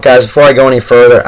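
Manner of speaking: usual speaking voice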